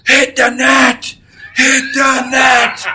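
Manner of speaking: furious drawn-out yell, high and loud; angry coach shout. Retold or imitated. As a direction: bellow it across the rink like a mad coach. Coach yelling at players in practice.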